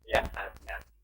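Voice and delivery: hushed word of caution; soft warning. Acted. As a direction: breathe the warning. Warning repeated softly.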